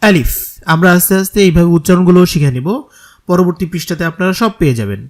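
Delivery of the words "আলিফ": আলিফ said firmly, short, with no drawl; firm alif. Shown as a demonstration. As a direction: say আলিফ hard with no stretching. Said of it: "শক্ত করে উচ্চারণ করতে হবে". (শক্ত করে টান ছাড়া আলিফ)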